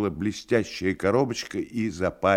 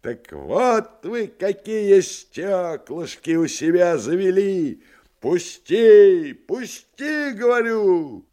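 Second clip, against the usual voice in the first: high, loud, strained screaming; shouting; 12 semitones higher, 7 dB louder, slower than usual. Acted. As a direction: scream while struggling free. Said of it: "Он хрипел ... кричал".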